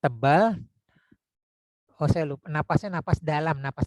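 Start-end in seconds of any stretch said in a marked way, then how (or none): 0.0-0.6 slow, louder thick chest voice. Shown as a demonstration. Teacher shows students a thick, authoritative voice. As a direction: lean into a full, thick chest tone slowly. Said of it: "ngomongnya yang tebal".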